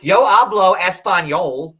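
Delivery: loud mock boast in Spanish; imitating Ed. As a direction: declare it loudly in beginner Spanish. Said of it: "like, Ed was like".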